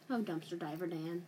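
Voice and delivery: ordinary talk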